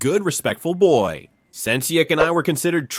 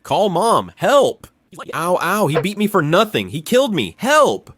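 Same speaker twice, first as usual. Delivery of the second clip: louder, higher mock yelling; screaming for help; 3 semitones higher, 4 dB louder than usual. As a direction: shout for help as if beaten.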